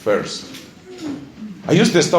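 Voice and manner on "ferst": "first" said low and soft as "ferst"; corrected pronunciation. Shown as a demonstration. Learner obeying the teacher, corrected pronunciation. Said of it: "entonces digo".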